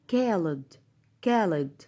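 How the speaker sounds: كالد with a hard English k. Shown as a demonstration. Teacher repeating the English reading, كالد with hard k. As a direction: say كالد opening on k, no throaty sound. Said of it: "لأ، هنطقها ك".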